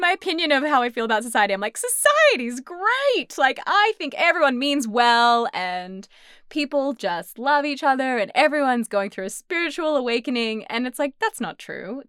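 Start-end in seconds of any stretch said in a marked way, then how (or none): 1.8-10.6 sing-song cheery 1950s housewife. Retold or imitated. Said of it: "makes you sound like a nineteen fifties housewife".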